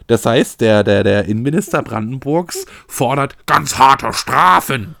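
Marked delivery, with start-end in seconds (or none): 2.9-5.0 loud, mock-stern proclamation; hammed-up call for punishment; mock severity. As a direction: blow up the minister's demand, rising in pitch. Mocks minister demanding harsh punishment.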